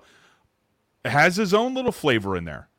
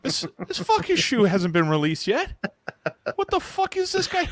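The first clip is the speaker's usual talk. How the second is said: mock incredulity; 12 semitones higher than usual. Host roasting co-host's top ten, mock incredulity. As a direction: act baffled, pitched way up. Acts out baffled reaction to the list.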